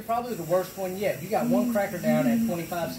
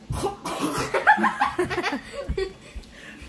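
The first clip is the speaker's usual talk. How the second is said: high laugh burst; 11 semitones higher than usual. Real, not acted. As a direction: laugh out hard. Big laugh at tease.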